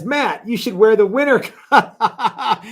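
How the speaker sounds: reads it slowly, breaking into a laugh; can't get through the line without laughing. Real, not acted. Reading a viewer's teasing comment.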